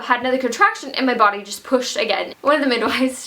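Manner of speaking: usual speaking voice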